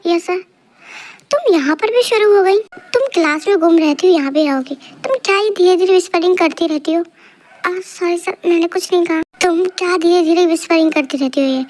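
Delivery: ordinary talk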